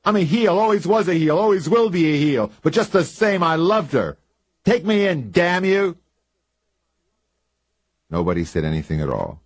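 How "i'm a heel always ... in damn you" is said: shouted high and loud in anguish; furious, desperate shouting at the sheriff; wild outburst. A narrator's reading. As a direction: yell it out, a broken man confessing. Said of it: "Then Bill Chess said wildly".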